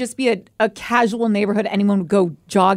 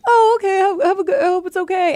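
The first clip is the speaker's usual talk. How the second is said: higher, breezy easygoing dad; mock dad voice; 9 semitones higher, 3 dB louder than usual. Brother imitating their dad brushing it off, higher breezy voice. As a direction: lightly imitate a carefree dad.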